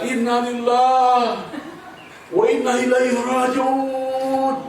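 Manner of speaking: high, slow, drawn-out cry of despair; terrified cry. Retold or imitated. Udin on the cliff edge cries out, high and drawn out. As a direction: wail the prayer as if about to fall. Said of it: "Dia teriak begitu".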